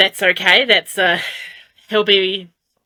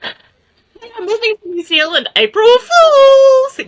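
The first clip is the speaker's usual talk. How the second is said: laughing, then a drawn-out, much higher, louder, slow "April Fools!"; mock April Fools; 5 dB louder, slower than usual. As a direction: sing out the prank reveal through a giggle, stretching it slow.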